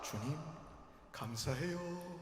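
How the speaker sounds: barely bother to say thanks; mumbled thanks. Retold or imitated. Believers thanking God in a mumble, soft. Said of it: "감사할 땐 어떻게 하는 줄".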